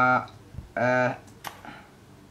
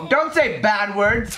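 slow, higher mock-stern shout; mock scolding shout; 9 semitones higher, 4 dB louder than usual. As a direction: scold him like a parent, hammed up. Scolding the game character.

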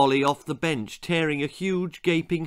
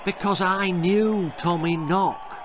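mysterious; 6 semitones higher than usual. A narrator's reading. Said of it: "said the old man mysteriously".

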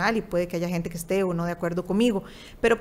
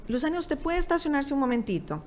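higher-pitched, careful request with no cheer; serious, not cheerful; 6 semitones higher than usual. Retold or imitated. Secretary asking her to pull over, serious and not cheerful. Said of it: "Pero en ese tono, no tono alegre".

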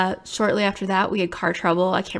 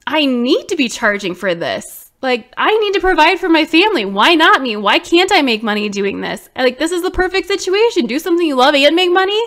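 higher and louder, insistent; retold indignant realization; 6 semitones higher, 6 dB louder than usual. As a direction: convince yourself you deserve it. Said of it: "I'm like".

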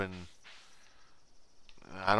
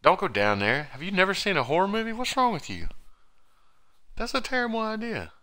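hammed-up horrified protest, higher and higher; alarmed scolding; 11 semitones higher than usual. Companion stopping kid entering the sewer, scolding in rising pitch.

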